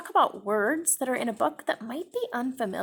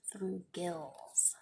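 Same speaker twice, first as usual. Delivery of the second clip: whisper; 3 semitones lower, 13 dB quieter than usual. Real, not acted. Reading a fact to children.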